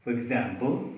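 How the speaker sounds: ordinary delivery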